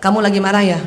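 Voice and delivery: gentle question, pitched low; caring mother. Acted. Mother asking her daughter if she's angry, gently. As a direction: check in tenderly, voice dropped. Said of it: "Kalau perempuan ditanya".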